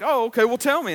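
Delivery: imitating an eager woman. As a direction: playfully demand to be told. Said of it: "she's like".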